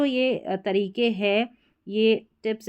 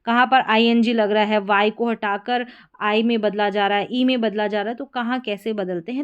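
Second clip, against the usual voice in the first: ordinary delivery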